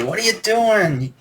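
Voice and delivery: high, drawn-out imitation of a yelling friend; mock a friend's outraged scolding without real volume; high-pitched mock outrage. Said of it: "was yelling at me".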